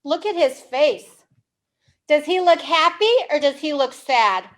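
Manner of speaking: usual speaking voice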